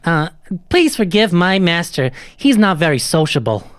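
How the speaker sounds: high, apologetic talking doll; high-pitched toy speaking for its master. Acted. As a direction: apologize as a servile little puppet. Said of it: "I was like".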